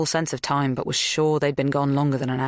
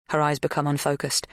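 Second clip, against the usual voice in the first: ordinary delivery, nothing marked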